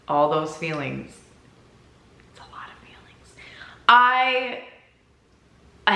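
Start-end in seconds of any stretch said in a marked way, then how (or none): 2.3-3.3 whisper. Acted. Whispered aside about her feelings.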